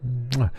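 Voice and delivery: quick "mwah" kiss noise; goodnight kiss. Pretend. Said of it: "Can you give me a good night kiss".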